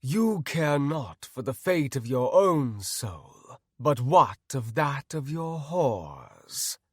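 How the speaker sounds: slow, grand menace; stately, drawn-out villain voice; zealous villain paladin. Acted. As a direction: condemn him slowly and grandly. Villain paladin Artemis speaks.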